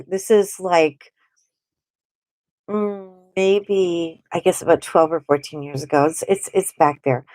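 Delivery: normal talking voice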